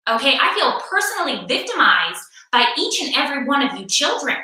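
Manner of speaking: ordinary talk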